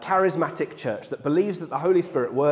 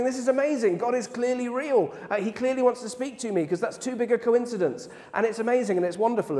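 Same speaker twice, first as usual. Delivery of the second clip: much higher, imitating her overwhelmed delight; retold tearful joy; 9 semitones higher than usual. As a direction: echo her teary amazement, pitched up high. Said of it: "And so she's crying".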